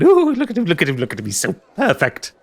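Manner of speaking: high, giddy creature delight; creepy giggly voice. Acted. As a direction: coo over him like a mad collector. GM voices giggling spider shopkeeper.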